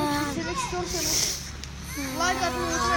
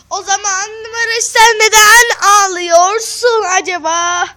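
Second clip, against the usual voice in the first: shouting; 4 semitones higher, 14 dB louder than usual. Real, not acted. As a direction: call out over the crying. Calls out to crying Barış. (yüksek sesle bağırarak)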